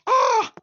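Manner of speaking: high, loud mock scream; imitated scream. Retelling a dog biting the villain, imitated scream. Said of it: "the dog bites him".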